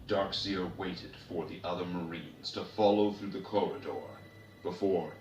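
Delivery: ordinary delivery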